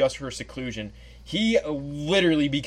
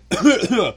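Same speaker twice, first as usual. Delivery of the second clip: loud, higher put-on cough; acted throat-clearing; 6 semitones higher, 5 dB louder than usual. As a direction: hack up something stuck in the throat. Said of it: "I had something stuck in my throat".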